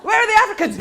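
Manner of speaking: very high, very loud hype yelling; comedy-show shout-out. Retold or imitated. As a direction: whip the crowd up like an MC.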